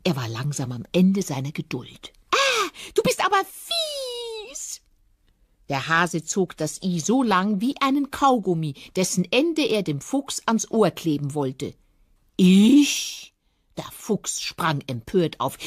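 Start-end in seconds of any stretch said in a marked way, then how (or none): 2.3-4.8 very high, slow whiny rabbit voice; quengeliger Hase. Acted. Hase schimpft mit Fuchs. 12.4-13.3 sharp indignant yelp; a loud offended Ich; indignant fox. Acted. Empörter Fuchs antwortet.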